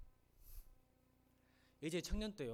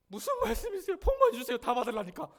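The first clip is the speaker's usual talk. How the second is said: much higher, louder squeal of a wife; mock wife's voice; 9 semitones higher, 13 dB louder than usual. Pastor mimics his wife wanting only blessings, high squeal. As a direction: play the wife gleefully grabbing blessings. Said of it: "물어봤더니".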